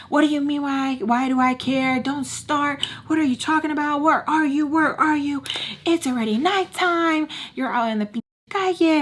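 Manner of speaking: high-pitched indignant boss voice; mock angry boss. Boss scolding the nanny on the phone, high and indignant. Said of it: "I'm gonna start in right away with him".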